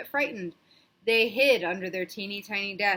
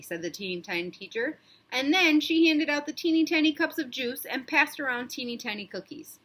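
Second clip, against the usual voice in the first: usual speaking voice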